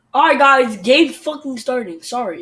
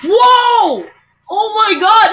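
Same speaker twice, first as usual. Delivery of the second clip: high, loud cry of disbelief; real frustrated outburst; outraged gamer yell; 6 semitones higher, 7 dB louder, much slower than usual. Real, not acted. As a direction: shout in protest at the game. Outraged reaction to the game.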